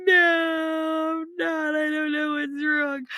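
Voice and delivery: high-pitched mock crying; tearful wail. Crying teacher answering her worried principal, tearful wail. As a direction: wail it out as if in tears. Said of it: "burst into tears".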